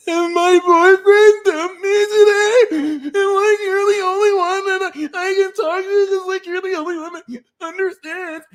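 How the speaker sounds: very high, loud tearful wail; hammed-up crying over a breakup; mock sobbing. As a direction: blubber it out in a squeaky put-on voice. Acting a friend crying over breakup.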